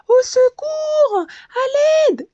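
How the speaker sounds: high, loud, slow cry for help. A narrator's reading. The bee struggling in the water, calling out loud and high. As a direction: wail for help as if sinking.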